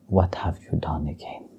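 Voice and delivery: hushed, breathy whisper of reproach; whispered question. Retold or imitated. Bhakti Devi whispering to Krishna about what he did. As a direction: lean in and whisper it. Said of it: "And she said".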